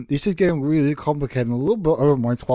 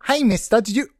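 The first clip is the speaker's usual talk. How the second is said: put-on kid voice; 8 semitones higher than usual. Child character voice.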